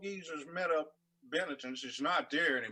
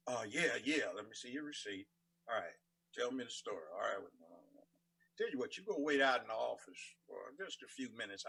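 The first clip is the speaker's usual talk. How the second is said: lower, softer Southern lawyer talk; developed lawyer voice; 4 semitones lower, 5 dB quieter than usual. Acted. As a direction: play a lawyer quietly handling a client in a low voice. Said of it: "But I developed it like this".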